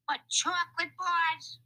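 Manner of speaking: very high, loud, outraged crook; angry. A narrator's reading. Lefty reproaches Louis for the candy haul, angry. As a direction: squawk it in outraged disbelief. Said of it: "Lefty said in an angry way".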